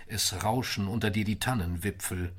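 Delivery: ordinary delivery, nothing marked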